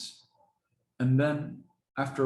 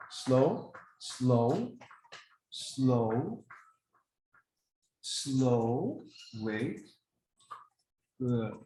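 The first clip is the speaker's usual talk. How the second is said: drawn-out calm command; much slower than usual. Real, not acted. Dogs taking food from hand.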